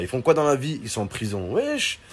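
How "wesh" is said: much higher, startled shout of disbelief; shocked wesh. Pretend. Hearing her brothers are in prison, shocked yelp.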